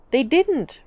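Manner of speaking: high, sharp retort; snapped. A narrator's reading. Shy girl snapping at the angry father, sharp. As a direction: cut him off with a snap. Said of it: "Rosalind snapped".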